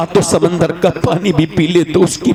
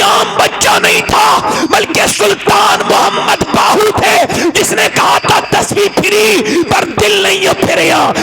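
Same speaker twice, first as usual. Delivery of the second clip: preaching at full force; 10 semitones higher than usual. Acted. Preacher to the crowd, building to a climax, full-force preaching shout. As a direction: preach it out with everything you have.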